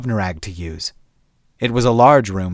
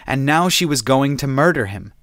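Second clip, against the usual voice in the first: ordinary delivery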